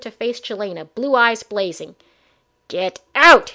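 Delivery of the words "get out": loud, snarling command; angry growl. Acted. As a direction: growl it with blazing anger. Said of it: "she growled".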